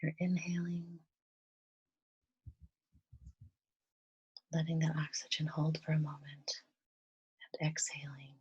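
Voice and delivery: soft, calm meditation voice with long pauses; guided meditation. Real, not acted. Guide leading listeners through breathing and gratitude, softly meditative. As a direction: gently guide them into relaxation, leaving space between phrases.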